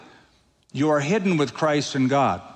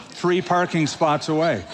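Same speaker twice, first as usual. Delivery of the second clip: ordinary talk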